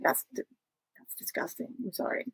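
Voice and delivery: very soft, nearly voiceless recoil; hushed, apologetic distaste; retold quiet disgust. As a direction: let it drop almost to a whisper. Retold recoil at friend's story.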